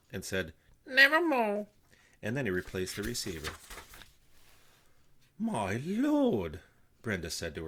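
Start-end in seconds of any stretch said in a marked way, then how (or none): 0.9-1.7 very high, loud squawk; crow voice. A narrator's reading. Mortimer squawking into the receiver, high and loud. 5.4-6.6 high, dismayed aunt; worried Brenda. A narrator's reading. Sister gossiping to her husband, high and dismayed.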